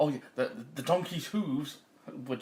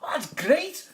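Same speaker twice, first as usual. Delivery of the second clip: high, loud dog enthusiasm; excited talking dog; 12 semitones higher, 6 dB louder, slower than usual. A narrator's reading. Dog delighted by donkey's plan, high and loud. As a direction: jump at the idea like an eager dog. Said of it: "said the dog".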